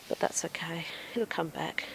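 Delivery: soft whispered reassurance; whispering. Real, not acted. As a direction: whisper it to the plant. Reassuring the wilted plant.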